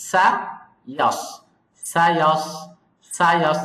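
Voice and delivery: light সা then heavy ইয়াস; thin সা, then full heavy ইয়াস; correct contrast. Shown as a demonstration. Teacher drills the right sounds, light then heavy. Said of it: "তাহলে একসাথে".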